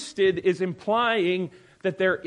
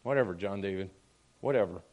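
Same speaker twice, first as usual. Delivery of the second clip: low, resigned brush-off; mock-tolerant, lower and subdued; passive dismissiveness; 5 semitones lower, faster than usual. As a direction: imitate someone passively shrugging off the argument. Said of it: "Now I'm more afraid of passive".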